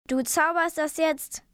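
understated angry order; shouted command. A narrator's reading. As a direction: order it done, only mildly fierce. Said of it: "Er schrie Mom an".

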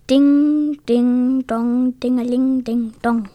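sing-song ding-dong plucking; imitated guitar notes. Elf practises his magic guitar.

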